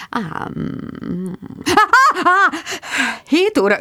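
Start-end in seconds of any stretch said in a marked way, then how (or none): 1.6-2.5 high sudden yelp; startled scream. Acted. Woken Mark makes her scream in fright, high yelp.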